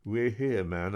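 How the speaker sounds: a hint of a Geordie accent; dazed patient in Geordie. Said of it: "Newcastle accent and using Geordie slang".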